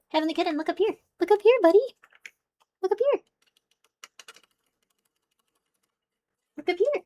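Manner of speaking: high, sing-song pet voice; pet talk. Real, not acted. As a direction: coax a kitten to look up. Coaxing the cat to look up.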